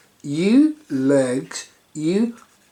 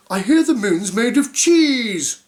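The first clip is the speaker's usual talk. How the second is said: higher, louder greedy voice; greedy character voice; 5 semitones higher, 5 dB louder than usual. Acted. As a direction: savour the cheese idea hungrily. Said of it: "he said, licking his lips".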